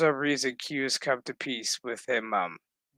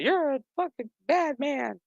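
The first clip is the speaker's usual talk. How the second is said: lightly voiced daughter; 11 semitones higher than usual.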